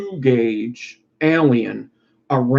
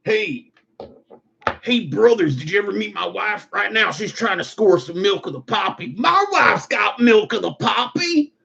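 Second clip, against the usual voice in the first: higher, put-on goofy voice; a sheepish confession to his brothers; skit character; 8 semitones higher than usual. A man tells his brothers about his wife, in a higher goofy voice.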